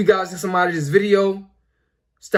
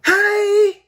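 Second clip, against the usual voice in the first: high-pitched, drawn-out "hey" yelled out; imitated yell; 13 semitones higher, 4 dB louder than usual. Girl yelling up in a student's face, imitated loud yell. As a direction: mimic a girl yelling right at someone. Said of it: "come up to you and just yell".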